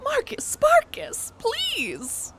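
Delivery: very high pleading voice; mock pleading. Streamer begging Mark, high mock plea. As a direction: plead in a squeaky high pitch.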